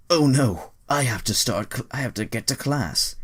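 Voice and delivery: high, put-on voice, worried; panicked thought. Boy late for class, high panicked voice. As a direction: fret about being late. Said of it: "Jacob thought to himself".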